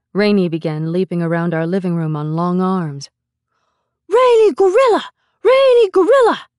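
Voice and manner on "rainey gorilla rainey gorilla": put-on high, loud child voice; small boy's big announcement, pitched way up; announcing little boy. A narrator's reading. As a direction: proclaim it like an excited small child. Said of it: "he announced".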